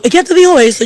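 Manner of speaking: put-on a grandma stunned by what the child heard; astonished question.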